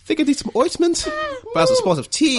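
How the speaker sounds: high, prim mock-English voice; mock posh. GM mocking the burn in a prissy high voice. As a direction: put on a fussy gentleman.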